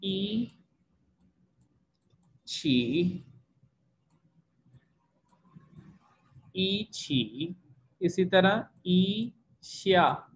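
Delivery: drawn-out Mandarin ई छी and ई श्या; yi tone demo. Shown as a demonstration. Teacher models yi examples slowly for students. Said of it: "जैसे आपके पास word है".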